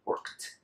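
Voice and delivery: "worked" as workt, nothing between K and T; D turned into T. Shown as a demonstration. Teacher models it with other sounds removed, ending in T. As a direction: close "worked" directly on KT. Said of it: "eliminate any other sound".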